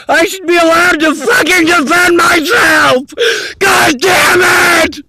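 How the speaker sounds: crying, shouting in real despair; wild unhinged ranting. Real, not acted. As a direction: rant through tears, slowing at the end. Desperate rant, crying and shouting.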